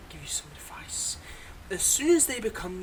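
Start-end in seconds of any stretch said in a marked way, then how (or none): 0.1-1.2 whispered advice. Acted. Conspiratorial advice to new hero.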